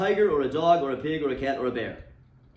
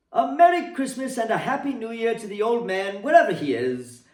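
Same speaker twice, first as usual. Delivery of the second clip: a lightly voiced, higher, louder hearty nephew; hearty toast; 7 semitones higher, 4 dB louder than usual. A narrator's reading. Fred toasting his absent uncle at the party, hearty. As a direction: raise a glass and call it out. Fred's toast to the old man.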